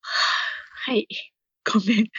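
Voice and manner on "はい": yawning through the word. Real, not acted. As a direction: answer mid-yawn, breathy. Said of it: "あくびしちゃった".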